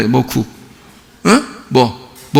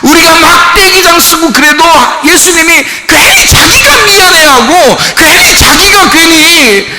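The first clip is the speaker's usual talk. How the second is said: high, loud, tearful preaching climax; fiery preaching; 11 semitones higher, 3 dB louder, slower than usual. Acted. Preacher crying out to the congregation, high and loud. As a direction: cry out about the Lord's love at full force. Preacher rising to full force.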